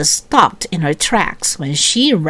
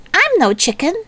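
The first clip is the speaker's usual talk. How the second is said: put-on a girl's defensive snap, pitched higher; snapping back; 10 semitones higher, faster than usual. A narrator's reading. Melody snapping at Eddie for calling her chicken. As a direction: bite the words back at him. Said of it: "Melody snapped".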